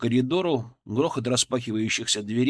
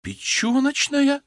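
high, drawn-out question; incredulous echo; slower than usual. A narrator's reading. Colleague questioning the diagnosis, incredulous. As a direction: repeat it in disbelief.

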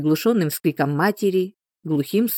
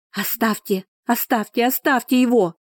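desperate scream; 6 semitones higher than usual. Acted. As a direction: scream it, building each time. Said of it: "закричала она".

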